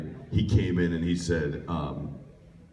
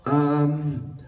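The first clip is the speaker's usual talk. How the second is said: put-on high, drawn-out hesitant kid voice; audition kid; 7 semitones higher, 4 dB louder, much slower than usual. Kid announcing his audition part, high and drawn-out. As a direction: stretch it out slowly as the kid. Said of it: "So he comes in".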